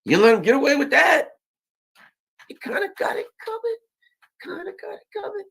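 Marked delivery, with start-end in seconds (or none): 2.5-3.8 high crying falsetto, sing-song; sarcastic whimper up high; falsetto taunt. Pretend. Taunting the leaders in a high mock falsetto. 4.4-5.4 high and much softer, breathy echo; faint falsetto echo. Pretend. Repeating the jab to the audience in a faint high falsetto.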